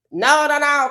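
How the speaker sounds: high, loud no no no; loud protest. Retold or imitated. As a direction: belt out the refusal. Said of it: "So we don't have to say".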